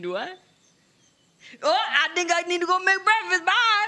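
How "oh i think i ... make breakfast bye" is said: high-pitched, nervous excuse to leave; awkward escape. Acted. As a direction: blurt an excuse and flee. Awkward character makes excuse to flee.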